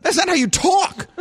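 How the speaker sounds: high-pitched, exasperated scolding; indignant disbelief. Acted. Father challenging the coach recruiting his son, indignant disbelief.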